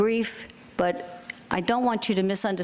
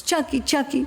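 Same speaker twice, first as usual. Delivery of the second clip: neighbor's worried plea; 8 semitones higher than usual. Retold or imitated. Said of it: "And she says".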